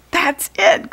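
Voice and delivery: jumps up high with the idea; a sudden bright outburst; excited cry. A narrator's reading. George hits on the plan, cries out. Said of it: "cried George".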